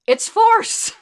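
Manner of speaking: high, loud mock-outraged cry; outburst. Mock-outraged outburst on forced voting.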